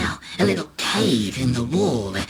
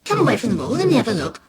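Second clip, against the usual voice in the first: high, coaxing cheerful dad; jolly parental persuasion; still cheery; 10 semitones higher, faster than usual. A narrator's reading. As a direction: coax brightly with pitch raised. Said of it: "Still cheery, he says".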